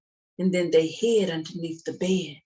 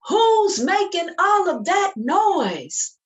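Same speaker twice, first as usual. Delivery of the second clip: high, loud and slow demand; booming parent call; 12 semitones higher, 6 dB louder, slower than usual. Shown as a demonstration. Storyteller booming at the children, loud. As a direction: call it out big for the kids to copy. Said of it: "Can you guys say".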